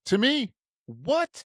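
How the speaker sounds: high-pitched incredulous question; mock disbelief. Teller playing up being turned down by girls, mock disbelief. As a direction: act affronted, pitch jumping way up.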